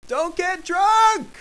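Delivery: high, slow drawn-out shout; warning yell. Acted. Schnorks warns the drinking Yumbly, yelling high. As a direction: holler it out, stretching the last word.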